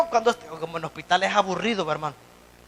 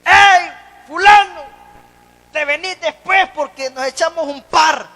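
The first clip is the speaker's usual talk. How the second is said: mock shout from street guys; 7 semitones higher, 9 dB louder than usual. Old street friends shouting at the preacher on the corner, loud mocking call. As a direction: yell the nickname like old street buddies. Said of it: "lo primero que me gritaron fue mi apodo".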